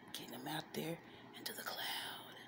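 breathy whispered aside; whispering. Real, not acted. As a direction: whisper it secretly.

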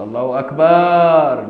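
أكبر called out as a long "akbaaar"; drawn-out ba. Retold or imitated. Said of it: "عايز يمد صوته".

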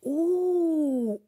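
ordinary delivery

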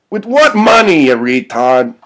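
high, loud first words, then dropping; irritated outburst; exasperated retort. Acted. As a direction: snap back at a foolish plan. Doug scoffs at Frank's plan.

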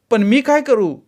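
high, loud snapping retort; sharp outburst. A narrator's reading. Bride flaring up at her mother-in-law, sharp and loud. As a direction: flare up at her. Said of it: "Sai कडाडली".

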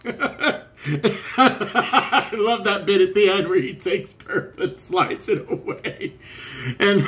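talking through a giggle; laughing. Real, not acted. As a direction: still laughing at the bit. Reacting to a funny clip.

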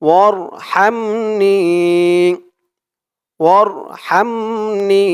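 ওয়ারহামনি with নি drawn one alif; held last vowel of ওয়ারহামনি; long ni. Shown as a demonstration. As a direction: stretch the নি at the end. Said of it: "নি একালিফ টান".

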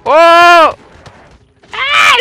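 very high loud screams; real scream; terrified yells. Real, not acted. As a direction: shriek at the ambush. Sudden zombie ambush.

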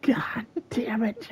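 hushed frustrated whisper; whispered curse. Real, not acted. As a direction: breathe the curse out slowly.

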